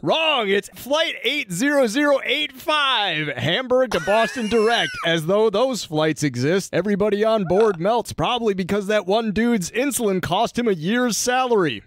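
punchy mock-dramatic episode summary; comic recap narration. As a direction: read the recap with showy, stretched emphasis.

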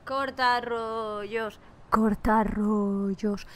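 slow, low, drawn-out "cortarrollos"; drawn-out mock taunt. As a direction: stretch "cortarrollos" syllable by syllable, low and teasing. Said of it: "tan cortarrollos". ("cortarrollos" muy lento y grave, en tono de burla)

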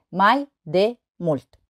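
slow, a pause between syllables. Shown as a demonstration. Teacher showing three syllables, syllable by syllable. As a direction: separate each syllable for counting. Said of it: "Trei silabe".

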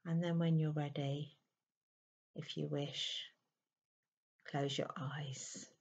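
unhurried, settling voice, leaving space to breathe; guided meditation. Real, not acted. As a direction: ease the listener into stillness, phrase by phrase.

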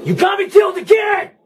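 high, raging yell; furious shout. Real, not acted. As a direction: scream the blame out. Dies in game, raging.

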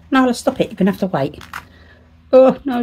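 normal talking voice

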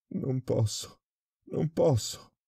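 high, desperate refusal near tears; refuse, voice breaking upward; about to sob. A narrator's reading. Prisoner refusing the director, close to sobbing. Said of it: "iniziò a singhiozzare".

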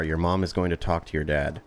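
ordinary talk

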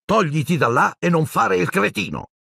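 high-pitched irritated order; exasperated outburst. A narrator's reading. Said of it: "sbottò Ron". (sbottando, tono acuto e spazientito)

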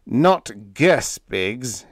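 loud, irritated correction; snapped. A narrator's reading. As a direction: snap it out, offended. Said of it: "he snapped".

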